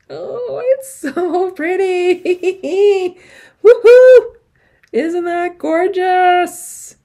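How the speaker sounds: high, slow gushing with a laugh and a loud woohoo; delighted gushing. Real, not acted. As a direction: squeal over how pretty it is. Showing off her finished stitching.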